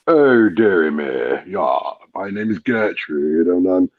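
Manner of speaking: slow posh English lady; a prim posh Chelsea lady. Pretend. Posh English, performing a posh lady on request. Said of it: "Can you do a posh one".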